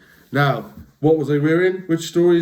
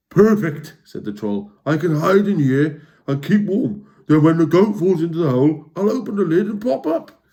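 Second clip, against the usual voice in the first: higher, louder gleeful plan; pleased troll; 3 semitones higher, 3 dB louder than usual. Acted. The Trawl plotting in the chest, gleeful. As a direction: sell the clever plan with glee. Troll plans his trap.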